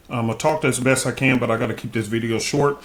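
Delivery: usual speaking voice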